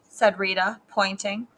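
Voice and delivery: usual speaking voice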